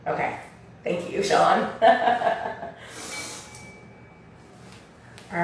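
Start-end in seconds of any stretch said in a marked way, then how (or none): 1.7-3.7 bright burst of high laughter; short, breathy real laugh. Real, not acted. Teacher thanking a class member, laughing. Reacting to listener's reply.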